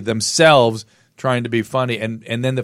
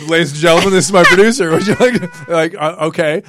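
stunned reply; 7 semitones higher, 6 dB louder than usual. Retold or imitated. His stunned reply to Roseanne.

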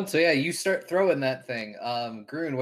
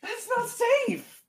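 indignant stranger; 4 dB louder than usual. Acted. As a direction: protest like a small indignant creature. GM voices shark-man's protest.

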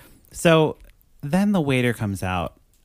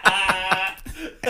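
high cackling laugh; 9 semitones higher than usual. Real, not acted. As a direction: burst out cackling. Laughing after the imitation.

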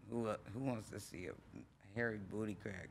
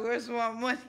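high, loud mock gangster baby; demanding baby voice; 12 semitones higher, 9 dB louder than usual. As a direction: play the baby shaking you down.

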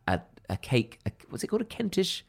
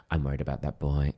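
put-on lower, softer worried old nan; 8 semitones lower, 5 dB quieter than usual. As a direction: murmur like a fretting grandmother. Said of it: "my nan would be like".

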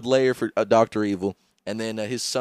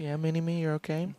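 soft cooing pet voice; softly cooing to Mini-Me; pet talk; 3 semitones higher, 10 dB quieter than usual. Retold or imitated. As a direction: coo at him like a pet. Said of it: "treating Mini-Me like a pet".

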